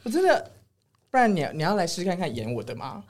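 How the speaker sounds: ordinary talk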